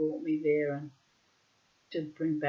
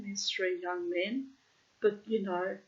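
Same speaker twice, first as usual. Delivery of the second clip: usual speaking voice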